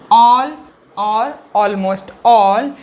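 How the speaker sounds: all punched loud, then or almost all slow; stress on all. Shown as a demonstration. Teacher repeating all for students, all stressed and slowed. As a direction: hit the word all hard and drawn out.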